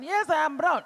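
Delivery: high, emphatic owning of it; proud retort. Acted. Answering someone who shames skin colour, proud retort.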